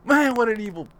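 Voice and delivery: normal talking voice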